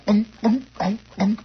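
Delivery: short, loud unk noises; mock grunting thumps; imitated stomach grunts. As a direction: punch out comic grunts in a row. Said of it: "And he goes".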